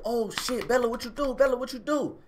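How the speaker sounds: high panicked cries to the dog; frightened calling of the dog's name; panicking. Retold or imitated. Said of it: "I'm like".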